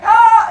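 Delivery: hammed-up cave-folk scream; startled scream. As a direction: yelp at the sudden fire. Humans see the fire.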